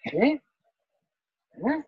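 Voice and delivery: high, rising demo of surprise; astonished eh. Shown as a demonstration. Showing how Hindi speakers react, astonished. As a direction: show sudden disbelief with a rising voice. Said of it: "In Hindi, in general term".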